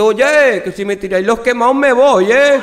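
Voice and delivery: high, loud outraged complaint; indignant shout. Acted. The Basque man yelling up at the people throwing from the building, indignant. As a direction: yell it up at them, fed up.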